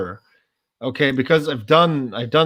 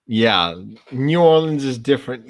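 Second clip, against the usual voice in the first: normal talking voice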